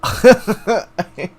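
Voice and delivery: high bursting laugh breaking into words; burst of laughter. Real, not acted. Gamer reacting to quiz result, laughing out loud.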